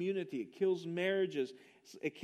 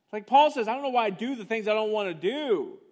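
full-force preaching; 3 semitones higher, 9 dB louder than usual. Acted. Preacher quoting Paul to the congregation, loud and fervent. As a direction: proclaim it to the back row.

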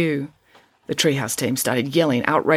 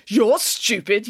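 higher, stretched-out angry retort; yelled retort; 5 semitones higher, much slower than usual. A narrator's reading. Jessica fights back at her teammate, yelling. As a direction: throw the insult straight back, drawn out. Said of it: "yelled Jessica".

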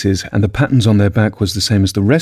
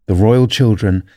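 plain reading voice

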